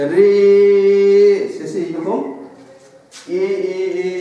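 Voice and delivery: এ sung and stretched long; drawn-out vowel. Shown as a demonstration. Teacher shows students the pluta vowel, sung and drawn out. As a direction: hold the এ like a sung note. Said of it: "এরকম করে টেনে টেনে উচ্চারণ হচ্ছে".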